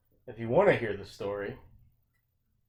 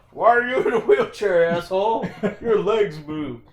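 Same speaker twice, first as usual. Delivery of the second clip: loud mocking yell at a stranger; yelling it out, hammed-up scorn; 7 semitones higher, 10 dB louder than usual. Mocking people in wheelchairs.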